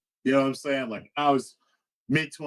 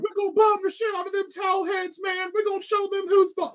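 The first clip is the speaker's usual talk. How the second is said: mock the flag-shirt tourist cheering for war; jingoistic American tourist. Imitating the flag-shirt tourist on their flight, in a high mock bro voice.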